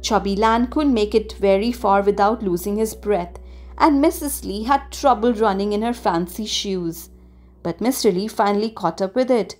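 ordinary delivery, nothing marked

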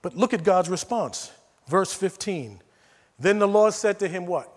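usual speaking voice

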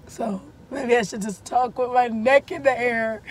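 usual speaking voice

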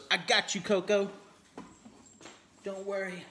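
high, loud pet talk. Real, not acted. Reassuring the dog.

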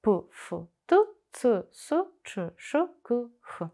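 п, ф, т, ц, с, ч, ш, к, х as short separate sounds; each sound from п to х cut short and spaced out; isolated voiceless consonants. Shown as a demonstration. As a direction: sound out п, ф, т, ц, с, ч, ш, к, х, not letter names. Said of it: "виникають глухі приголосні".

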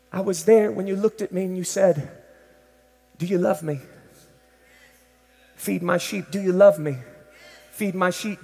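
soft, low, tender confession; hushed and broken, near tears; quiet Peter. Acted. Peter confessing his denial to Jesus, quiet.

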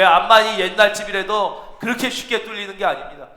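usual speaking voice

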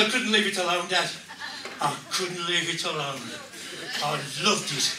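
low, soft and lingering; mock-intimate low murmur; acted hushed confession. Son's confession acted for the joke.

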